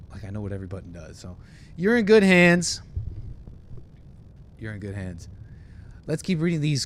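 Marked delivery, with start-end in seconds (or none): 1.8-2.8 high, loud, drawn-out assurance; loud mock reassurance. Mock pilot reassuring passengers. 4.6-5.3 soft, low pilot murmur; quiet reassurance. Pretend. Repeats the reassurance softly.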